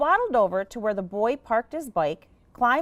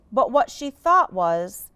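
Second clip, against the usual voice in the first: ordinary delivery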